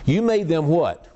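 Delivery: You made them what? with made leaned on and slow; a preacher slowing to stress "made". Retold or imitated. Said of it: "here's the emphasis".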